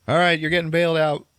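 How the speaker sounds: loud, high jailer announcement; imitated guard's brisk holler; calling out. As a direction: bark it into a holding cell. Jailer calls out to prisoner.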